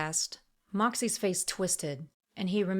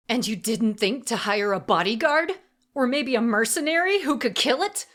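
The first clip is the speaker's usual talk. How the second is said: higher, loud, scornful snarl; 5 semitones higher, 7 dB louder than usual. A narrator's reading. Assassin berating the auctioneer, snarling. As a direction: spit the words in his face. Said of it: "Emery snarled".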